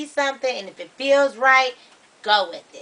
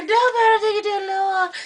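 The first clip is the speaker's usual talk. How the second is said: high, fast imitation Chinese; caricatured Chinese accent, pitched up and hurried; mock Chinese accent; 10 semitones higher, much faster than usual. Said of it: "turn Chinese and be like".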